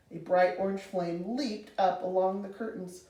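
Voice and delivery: ordinary delivery, nothing marked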